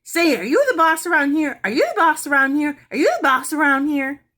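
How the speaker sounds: loud, high parrot squawk; parrot yelling. Acted. Parrot yelling at Mary's mother about who is boss. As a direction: hop and yell it like a rude bird. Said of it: "blinking and yelling".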